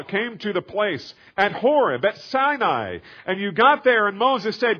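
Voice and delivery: high, loud, fervent sermon build; preaching at full force. Acted. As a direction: drive it home from the pulpit.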